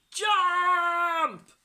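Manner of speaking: high, drawn-out pirate yell. A narrator's reading. Pirates order troll off plank, yelling. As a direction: bellow it long and loud. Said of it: "yelled the pirates".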